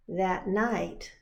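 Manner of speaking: plain reading voice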